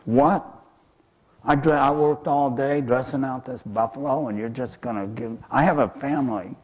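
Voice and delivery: cheated worker protesting in disbelief; indignant protest. Acted. Cheated fox protests to mouse.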